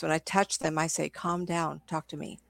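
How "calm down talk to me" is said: soft, soothing hush to a troubled spirit; soft calming voice. Shown as a demonstration. Investigator soothing an upset spirit, soft calming voice. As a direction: ease them down gently, softer and softer. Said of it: "in a soft voice".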